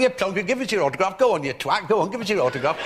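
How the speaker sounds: high, loud fake Cockney heckling; rowdy lads' botched Cockney; bad mock Cockney. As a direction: badger him in a badly faked Cockney accent. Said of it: "a rather bad approximation of a Cockney accent".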